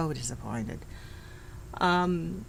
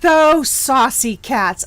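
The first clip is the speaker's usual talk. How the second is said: slow, louder, higher scold; tut-tut in playful outrage; mock indignant; 6 semitones higher, 7 dB louder, much slower than usual. Real, not acted. Reacting to teen rudeness.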